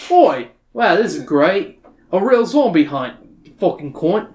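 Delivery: sarcastic Australian bloke; Australian accent. Acted. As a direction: grumble it in a broad Australian accent. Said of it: "great Australian accent like I can".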